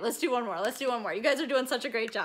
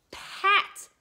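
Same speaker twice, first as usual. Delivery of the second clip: ordinary talk, nothing marked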